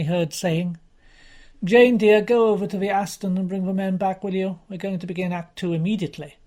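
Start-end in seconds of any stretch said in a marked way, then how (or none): 1.6-6.4 calling out. Acted. Producer calling across rehearsal.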